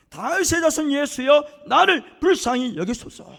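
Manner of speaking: loud, slow, drawn-out plea; crying out. Retold or imitated. Blind beggar crying out to Jesus, loud pleading call. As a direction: call out with all your strength, begging. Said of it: "있는 힘을 다해서 소리 질러요".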